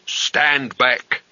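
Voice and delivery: plain reading voice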